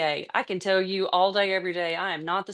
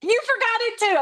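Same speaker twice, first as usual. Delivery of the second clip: amused surprise; 14 semitones higher, 4 dB louder than usual. Real, not acted. Teasing colleague who forgot too.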